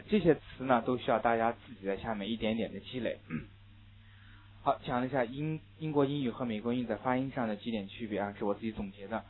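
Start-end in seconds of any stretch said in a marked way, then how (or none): none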